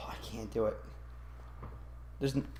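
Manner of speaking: a soft unvoiced whisper. Real, not acted. Refusing to review the sequel, whispered. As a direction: breathe it out, defeated.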